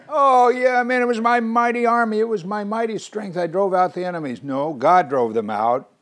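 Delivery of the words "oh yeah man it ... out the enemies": much higher and louder cocky boast; boastful bragging. Pretend. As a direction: swagger about your own mighty army. Imitating boastful Israelites taking credit.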